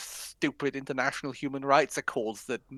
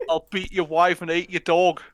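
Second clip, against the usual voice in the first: louder mock Southern English threat; hammed-up London thug threat; mock Southern English accent; 4 semitones higher, 8 dB louder than usual. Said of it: "Is that Southern English?".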